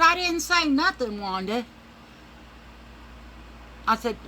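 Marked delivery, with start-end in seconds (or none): 0.0-1.6 fake innocent.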